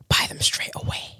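breathy sexy whisper, soft. Acted. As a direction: whisper it close to the mic. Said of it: "Oh, with the whisper?".

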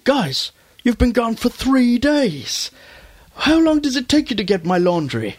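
an annoyed shopkeeper who only wanted his laundry; grumpy shopkeeper pig. Retold or imitated. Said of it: "He goes".

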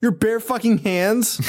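slow, high disbelief; can you believe this; incredulous. Real, not acted. Incredulous at movie scene.

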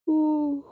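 high sleepy yawn. A narrator's reading. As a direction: let out a big yawn. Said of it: "he yawned again".

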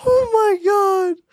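surprised. Real, not acted. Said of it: "the most surprising thing".

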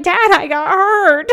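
high, drawn-out mock whine; plaintive, stretched-out complaint to a parent; whining to dad. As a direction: act out a hurt kid's protest. Acted whiny reply to dad.

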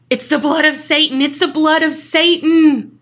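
retold frantic shouting. Storyteller imitates the blood-covered man shouting at police, mock shouting. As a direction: shout it like a doomsday warning, acted. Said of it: "he starts shouting".

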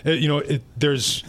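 ordinary talk, nothing marked

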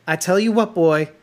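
mock Southern accent through a Northeastern one; loud, high-pitched bad Southern "boy" talk; Pope's Southern drawl. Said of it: "in my stupid Northeastern accent".

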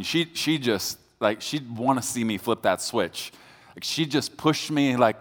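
normal talking voice

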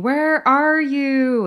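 long, drawn-out high call; retold scream. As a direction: call out slowly into the void. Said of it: "she's screaming".

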